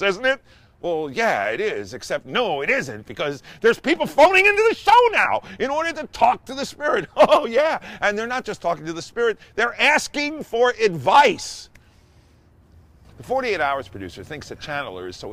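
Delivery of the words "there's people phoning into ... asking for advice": excited. Real, not acted. Incredulous retelling, callers phone in.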